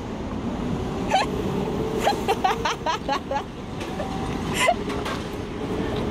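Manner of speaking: airy high-pitched giggle; high breathless laugh. Real, not acted. Laughing at the koala.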